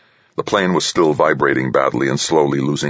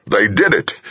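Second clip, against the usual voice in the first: higher, triumphant crow; 6 semitones higher than usual. A narrator's reading. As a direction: crow over the victory. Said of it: "General Higgins crowed".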